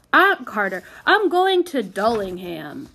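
gruff, loud country carter; growling carter. A narrator's reading. Sour carter explaining his route, growling. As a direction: growl it heavily, unhelpful. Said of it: "growled the carter".

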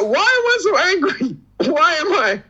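high-pitched, exasperated self-questioning; mock angry. Podcaster retelling his rage at British accent videos, mock angry. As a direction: protest to yourself in a high, worked-up voice. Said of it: "I got so fucking angry".

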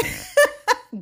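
high, loud cracking laugh; burst of laughter. Real, not acted. Laughing at her own singing, high cackle. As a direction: cackle at how bad that sounded.

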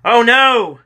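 high, loud drawn-out "oh no"; alarmed cry. A narrator's reading. James crying out as he falls toward the filthy water, alarmed. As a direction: cry out as disaster hits. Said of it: "these were James' last words".

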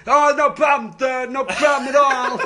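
loud, high, slurred imitation of deaf speech; mock deaf voice. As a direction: put on a crude fake deaf voice. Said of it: "I did a deaf voice".

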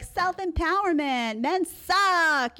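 high, mocking quote of the slogan; mocking young women. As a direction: parody them in a high sneering voice. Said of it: "they're like".

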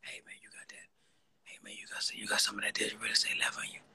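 hushed, furtive imitation of a shy buyer; mock whisper. Host mocking caller asking for digital real estate, whispering. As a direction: whisper it as if scoring something illegal. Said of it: "Man, got the whispering".

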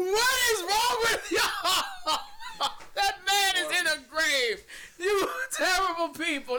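high, laughing outcry; laughing disbelief. Real, not acted. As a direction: shriek through laughter. Shocked by joke.

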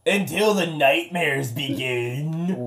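slow raspy pirate growl. Acted. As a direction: drag it out like a chain-smoking pirate. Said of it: "Why do you sound like a pirate?".

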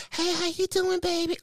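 high, soft mock-female voice; womanly voice. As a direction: imitate a woman flirting, high and light. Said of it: "All sound like a woman".

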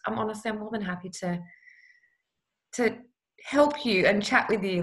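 ordinary talk

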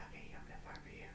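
very soft, voiceless whisper; hushed imitation assistant; whispering back. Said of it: "Alexa's whispering back".

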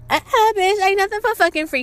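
high, loud sassy comeback; mock-indignant, high-pitched clapback; sassy refusal. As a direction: shut them down with loud, high attitude. Acted sassy comeback to the fan.

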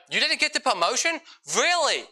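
high-pitched, put-on disbelief; mock surprise. Preacher playing coworker of passed-over slacker, mock surprise. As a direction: feign amazement, pitch jumping right up.

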